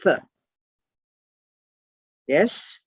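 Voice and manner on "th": short isolated "th" sound; letter sound alone. Shown as a demonstration. Teacher showing how the letter sounds, short isolated "th". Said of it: "T A U pronounced tov is".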